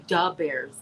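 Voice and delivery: "da bears" with "da" for "the"; "da" replacing "the" before "bears"; Chicago "da". Shown as a demonstration. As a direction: say "da bears" like a Chicago fan, hard d. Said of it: "we say".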